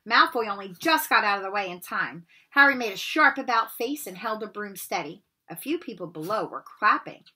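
plain reading voice

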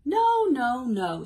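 emphatic mock protest; protesting piggy. A narrator's reading. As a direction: object firmly in a storybook voice. Piggy objects to grabbed ball.

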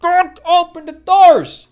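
furious yell. Real, not acted. Streamer yelling at villagers to keep doors shut, furious. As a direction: bark it stretched out.

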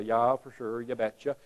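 put-on lightly done Minnesota accent, soft and low. Mock Minnesotan agreeing, light Minnesota accent. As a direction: drop into a mild Minnesota accent. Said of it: "let's say you're from Minnesota".

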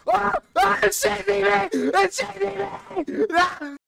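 excited shouting. Real, not acted. Panicked then relieved in game.

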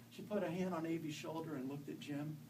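ordinary delivery

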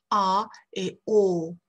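"A et O" drawn out slowly; long vowels A and O. Shown as a demonstration. Teacher introducing long vowels, drawn out. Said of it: "les deux voyelles longues de l'anglais".

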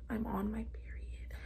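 hushed confiding whisper. Real, not acted. Telling viewers why she cried, whispered. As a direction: drop to a whisper for a secret.

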